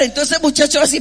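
ordinary talk

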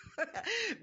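a bright, high real laugh; sudden high laugh; high burst of laughter. Real, not acted. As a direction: laugh out at the irony. Laughs at being called strong.

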